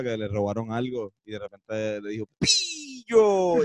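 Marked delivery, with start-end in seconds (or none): 2.4-3.6 high, long cry in neutral dubbing Spanish; acento neutral de doblaje. Retold or imitated. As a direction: call out like a dubbed cartoon victim. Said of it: "el acento neutral". (grito agudo con acento de doblaje neutral)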